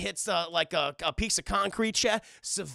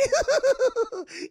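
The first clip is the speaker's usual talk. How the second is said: high, loud villain cackle; shrill maniacal laugh; evil demon cackle; 8 dB louder than usual. Retold or imitated. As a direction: cackle like a gleeful monster. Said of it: "the Mark Hamill demon pops up. He's like".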